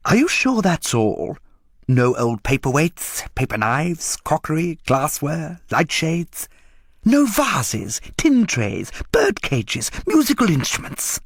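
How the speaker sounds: high, rising coaxing list of wares; eager dealer list. A narrator's reading. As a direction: reel them off hungrily. Dealer pressing for more.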